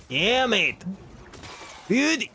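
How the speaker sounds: high, hammed-up Australian accent; laughing at his own Australian accent; mock Australian. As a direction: throw out a badly faked Australian cheer. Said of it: "have to speak like a pirate".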